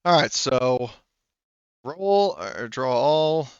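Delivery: ordinary talk, nothing marked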